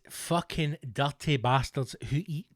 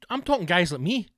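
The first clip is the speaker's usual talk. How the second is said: usual speaking voice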